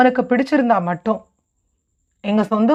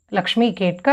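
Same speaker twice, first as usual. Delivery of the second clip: plain reading voice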